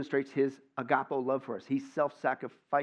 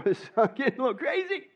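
nervous laugh; 10 semitones higher, 3 dB louder than usual. Acted. Burglar laughing it off.

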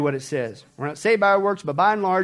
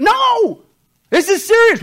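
emphatic no; 11 dB louder, slower than usual. Acted. Preacher rebuts the excuse.

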